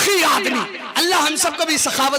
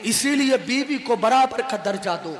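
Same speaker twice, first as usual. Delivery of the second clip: ordinary talk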